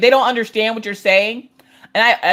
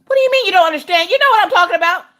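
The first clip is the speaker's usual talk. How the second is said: fast, high, loud retort; comic outrage at the device; exasperated outburst; 12 semitones higher, 5 dB louder, faster than usual. Acted. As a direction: snap back fast, pitched way up. Yelling back at Echo.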